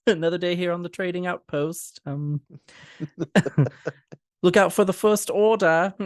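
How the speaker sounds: high, hammed-up alien trader voice; a playful in-character warning, pitched high; cast member in character. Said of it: "they'll be like".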